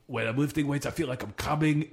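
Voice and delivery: normal talking voice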